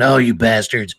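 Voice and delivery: cartoon clown cursing the kids; hammed-up grouchy clown voice; Krusty the Clown. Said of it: "you know, Krusty the Clown".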